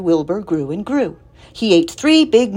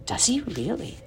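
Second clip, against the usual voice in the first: soft, higher, absent reply; quiet, distracted question; vague; 4 semitones higher, 9 dB quieter than usual. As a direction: murmur it with the mind elsewhere. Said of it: "said Missus Arable rather vaguely".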